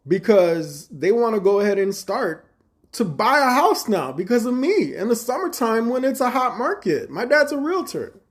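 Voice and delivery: high and loud, building proud indignation; indignant excitement. Retold or imitated. Arguing his sales with manager.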